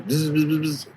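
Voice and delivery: put-on buzzing like an old rotary phone ringing; imitated phone buzz. Describing his grandpa's old phone, imitating the ringing buzz. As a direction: mimic the phone's bzz bzz ring.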